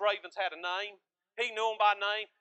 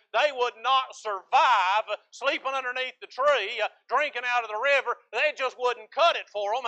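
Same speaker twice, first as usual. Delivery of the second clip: louder, rising sermon delivery, pressing each point; emphatic preaching; 4 semitones higher, 6 dB louder than usual. Acted. Pastor to his congregation, loud emphatic preaching. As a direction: preach it out to the pews with force. Preacher building to full force.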